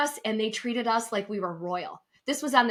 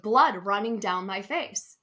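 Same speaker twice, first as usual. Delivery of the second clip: ordinary talk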